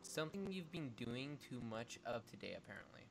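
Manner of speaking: low Victoria voice. Acted.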